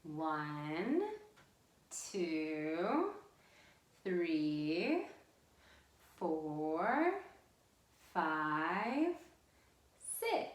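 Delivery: slow count, each number sliding upward; drawn-out singsong counting; counting along. Real, not acted. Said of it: "count 'em back with me".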